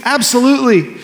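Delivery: high emphatic absolutely; the believer's high, emphatic answer to the preacher; eager answer. Retold or imitated. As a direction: answer as if it's obvious. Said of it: "Do you want him to save you?".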